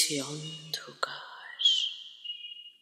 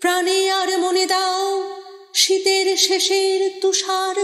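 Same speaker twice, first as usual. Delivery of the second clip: high, sustained, almost chanted declamation; rousing poetic prayer at full voice; impassioned invocation; 6 semitones higher than usual. Acted. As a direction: invoke strength with ringing, held vowels. Poem's rallying invocation for strength.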